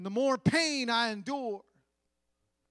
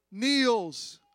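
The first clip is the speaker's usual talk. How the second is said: usual speaking voice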